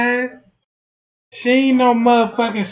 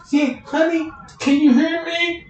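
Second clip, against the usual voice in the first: mock psychic voice; 10 semitones higher than usual. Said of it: "you gotta talk like a demon".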